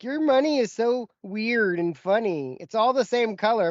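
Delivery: imitating Canadian girl. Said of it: "And then she was like".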